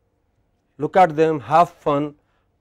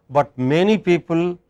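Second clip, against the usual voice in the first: ordinary delivery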